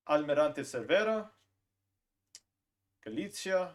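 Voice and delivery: normal talking voice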